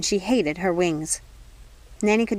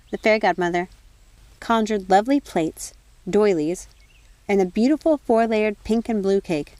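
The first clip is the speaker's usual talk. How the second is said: plain reading voice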